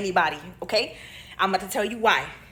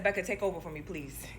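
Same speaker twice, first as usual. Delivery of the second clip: much softer, trailing into a low "please"; retold tearful plea; 3 semitones lower, 10 dB quieter than usual. Nurse asking her friend to take over, hushed retold plea. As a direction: quietly imitate begging a friend while tearful. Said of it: "now I'm crying in front of her".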